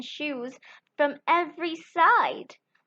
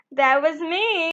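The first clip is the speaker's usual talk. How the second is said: sly fox; 3 semitones higher, 4 dB louder than usual. Acted. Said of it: "The fox said".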